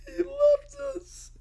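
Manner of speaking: very high, drawn-out tearful wail. Acted. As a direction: cry it up into a squeal. Kid abandoned in maze.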